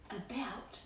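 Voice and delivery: a single whispered word; whispered aside. Acted. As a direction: drop to a breathy whisper. Whispered word mid sentence.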